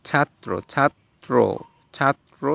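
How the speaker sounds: slow ছাত্র as "chhat-tro"; doubled consonant. Shown as a demonstration. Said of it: "শেষে দুইবার উচ্চারণ হয়েছে".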